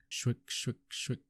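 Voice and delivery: soft breathy shwick of a blade on stone; sharpening sound effect. Retold or imitated. Sharpener at his stone, voicing the blade's scrape as a sound effect. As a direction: mouth the scrape of steel on a whetstone.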